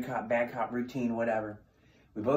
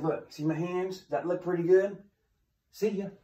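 high, louder mocking taunt; 6 semitones higher, 3 dB louder than usual. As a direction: rub it in, then leave. Taunting inmate after hitting wall.

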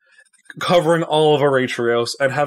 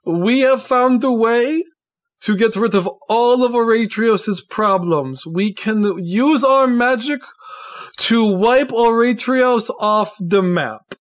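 higher-pitched, grandly theatrical declaration; villainous Tristan proclaiming; 8 semitones higher than usual. Acted. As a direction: announce the sinister plan to the whole ball. Tristan reveals his villainous plan.

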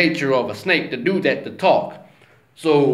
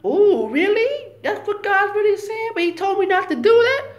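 high, wide-eyed woman's voice; much higher, wondering and tempted; Eve tempted. Retold or imitated. Eve doubting God's word to the serpent, high and wondering. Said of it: "In Eve's mind".

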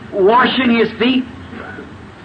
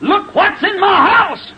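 angry, high raised shout with drawn-out words; raging; 7 semitones higher, slower than usual. Acted. Simon fuming to guests about who is in his house, raging. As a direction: cry out in slow, high indignation. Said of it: "my, his face raged".

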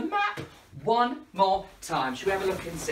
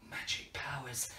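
secret whisper; hushed, mysterious magic whisper; 6 semitones lower, 13 dB quieter than usual. Pretend. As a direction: breathe it like a secret. Secretive aside to children.

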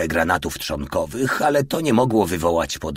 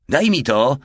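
higher, louder grumbled order; gruff demand; 7 semitones higher, 5 dB louder than usual. A narrator's reading. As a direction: demand it with brusque impatience. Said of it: "burknął Porta". (opryskliwie, głośniej i wyżej)

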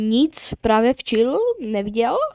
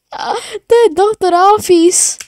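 high awed voice; 8 semitones higher, 4 dB louder than usual. Acted. Voicing character, recognition.

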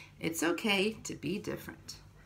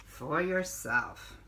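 put-on reading to children. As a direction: read each page to little ones, unhurried. Reads picture book to children.

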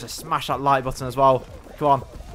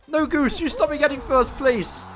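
much higher, squealing plea; desperate squeal; 9 semitones higher than usual. Real, not acted. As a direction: shriek at the goose in despair. Goose knocks him from first.

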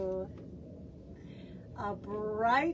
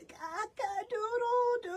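much higher, thin little croaked cock-a-doodle-doo; weak crow; 11 semitones higher than usual. Acted. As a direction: try to crow but it barely comes out. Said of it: "was very soft".

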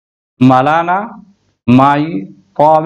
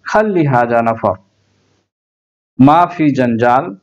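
ordinary delivery

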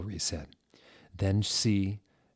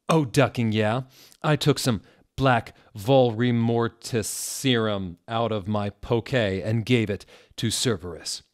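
louder, higher, eager telling; excited; 3 semitones higher, 6 dB louder than usual. Acted. Narrator excitedly bragging, louder and higher. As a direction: rush the brag out with glee.

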